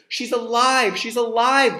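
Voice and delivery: loud, high joyful cry; excited cheer. Acted. Villagers cheering that Messina lives, joyful shout. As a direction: shout it out with joy. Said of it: "they cried".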